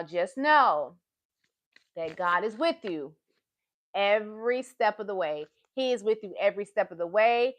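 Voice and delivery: ordinary talk, nothing marked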